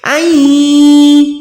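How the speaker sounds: nasal hum held in the nose; nasal ghunna. Shown as a demonstration. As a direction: push the sound into the nose. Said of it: "आवाज को नाक में ले जा रही हूँ". (नाक में गुन्ना)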